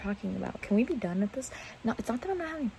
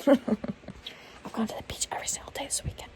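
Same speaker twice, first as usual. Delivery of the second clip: higher breathy whisper, giggly brag; bragging; 5 semitones higher than usual. Shown as a demonstration. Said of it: "That is me trying to brag".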